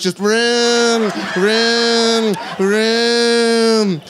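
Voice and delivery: mock engine rev. Mocking the engine noise.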